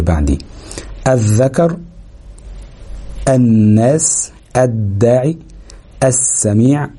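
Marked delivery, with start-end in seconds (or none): 3.3-4.3 الناس very slow with a doubled ن; الناس بنون مشددة ببطء; slow doubled nun. Shown as a demonstration. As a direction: draw out الناس, no lam. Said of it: "كلها مفيش لام".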